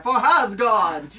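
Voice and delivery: slow, laughing retold war cry; viking battle cry. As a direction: drag it out, cracking up. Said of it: "and I kept saying it".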